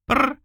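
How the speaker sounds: high purring trill; a tiny cat's chirp; kitten trill. A narrator's reading. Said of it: "согласился котёнок".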